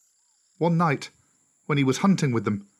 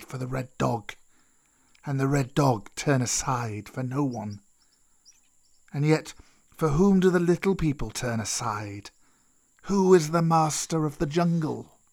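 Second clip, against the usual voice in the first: put-on old snake Kaa; 3 semitones higher than usual.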